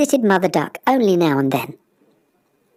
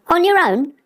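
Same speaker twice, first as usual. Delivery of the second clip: curious swan; 10 semitones higher than usual. Acted. Second swan asks, surprised.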